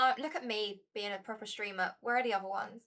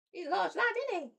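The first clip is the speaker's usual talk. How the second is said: much higher sing-song pet voice; 9 semitones higher, faster than usual. Real, not acted. Owner coos to her cat in pet talk, high and sing-song.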